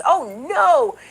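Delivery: a high mock gasp of alarm; hammed-up fake panic. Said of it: "They were just".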